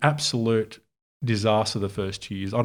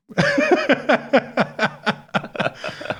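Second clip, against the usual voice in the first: long high peal of laughter. Real, not acted. Retelling the manager's loan trick to the host, a long high laugh.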